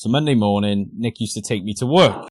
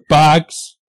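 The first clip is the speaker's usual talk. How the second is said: gruff bark; 5 semitones higher, 9 dB louder than usual. Retold or imitated. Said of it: "he'd just be the angriest bloke".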